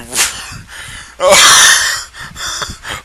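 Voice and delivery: unvoiced helpless laugh; breathy laughing fit. Real, not acted. Narrator laughing before the sex scene. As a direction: choke on the giggles. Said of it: "trying not to laugh".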